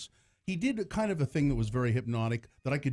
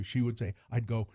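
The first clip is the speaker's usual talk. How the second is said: normal talking voice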